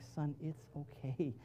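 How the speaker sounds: tender father telling his son it's okay to cry; gentle reassurance. Retold or imitated. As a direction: hush and soothe a hurt son. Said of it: "I'm like".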